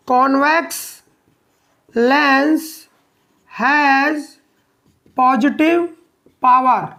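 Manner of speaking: slow, word-by-word dictation with pauses. Real, not acted. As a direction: dictate it for pupils writing notes, pausing after each word. Dictating notes for pupils to write. (रुक-रुक कर notes लिखवाते हुए)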